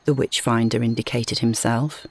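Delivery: ordinary delivery, nothing marked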